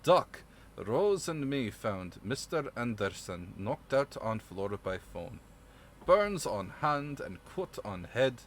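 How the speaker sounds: slow, slurred, badly faked Russian accent; heavy-handed mock-Russian accent, dragged out. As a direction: read it slowly as a clumsy Russian, like chewing potato. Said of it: "I need a Russian accent for this".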